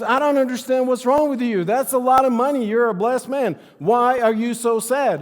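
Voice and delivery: high, loud, baffled disbelief; baffled friend. Retold or imitated. Friend puzzled by the sad man, baffled and loud. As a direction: throw up your hands at him.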